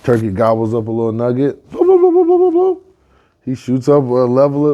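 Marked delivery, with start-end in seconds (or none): 1.7-2.8 very high, loud burbling gulps; rapid high-pitched bloop-bloop gobble; cartoon gobbling noise. Turkey gobbling up a nugget, high cartoon burble.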